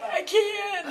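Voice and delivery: high, laughing wail; laughing squeak. Retold or imitated. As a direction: squeak it out, laughing and half crying. And I was like.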